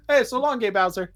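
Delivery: a light, high-pitched send-off; Mario's farewell. Pretend. Mario leaving his new friend, light high farewell. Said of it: "Mario leaves and just says".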